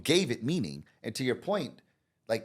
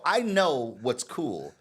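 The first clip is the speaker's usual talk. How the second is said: slow smug dad; 2 semitones higher, 4 dB louder, much slower than usual. Retold or imitated. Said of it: "I go".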